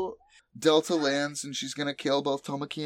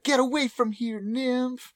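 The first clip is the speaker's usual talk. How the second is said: high-pitched, louder mock yell; mock battle cry, pitched way up; 13 semitones higher, 4 dB louder than usual. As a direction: yell it high like an anime heroine sending someone packing. Retold anime attack scene.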